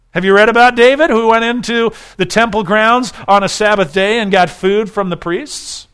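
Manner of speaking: emphatic rabbinic retort; forceful counter-question. Retold or imitated. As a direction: turn the question back on them.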